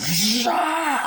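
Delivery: very high, sustained mock screech; imitated attack noise. Retelling the chainsaw attack on the bus, mock screech.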